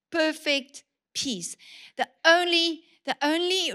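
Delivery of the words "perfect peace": slow savoured phrase. Acted.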